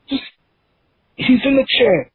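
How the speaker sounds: ordinary talk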